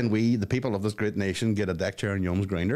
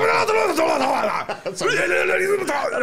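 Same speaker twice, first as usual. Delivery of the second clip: loud shrieking laugh; 6 dB louder than usual. Real, not acted. Huge laugh at crude joke.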